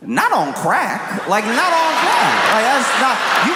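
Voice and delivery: exaggerated outraged yell; mock outrage. Mock-outraged crack bit.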